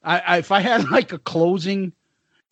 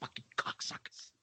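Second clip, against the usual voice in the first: near-whispered swearing; muttered cursing; 23 dB quieter than usual. Retold or imitated. Teacher at his last straw, muttering curses. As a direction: curse almost silently under your breath. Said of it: "he would mumble under his breath".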